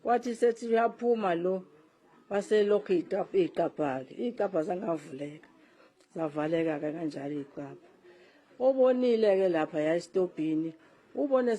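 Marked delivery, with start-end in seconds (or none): none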